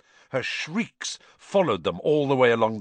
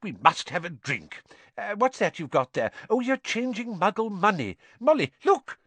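excited, climbing ever higher; delighted; 11 semitones higher than usual. A narrator's reading. Mister Weasley fusses over Muggle money, delighted. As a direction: build to a high, thrilled cry. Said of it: "said Mister Weasley delightedly".

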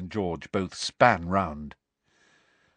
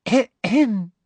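high, prim "ahem"; fussy throat-clearing; 13 semitones higher, 6 dB louder than usual. A narrator's reading. Umbridge about to read the parchment, fussy throat-clearing. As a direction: clear the throat with self-importance. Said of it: "clearing her throat fussily".

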